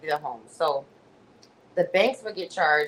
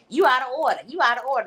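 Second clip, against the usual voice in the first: higher, louder mock scolding; mimicking Tiffany; 6 semitones higher, 3 dB louder, faster than usual. Speaker mocking Tiffany's catchphrase at Valerie, higher sneer. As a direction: parrot her catchphrase with a sneer. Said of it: "That's her favorite thing".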